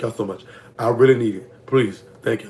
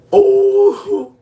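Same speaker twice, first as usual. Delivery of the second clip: long high loud oh of realization; a real sudden shriek; 5 dB louder than usual. Real, not acted.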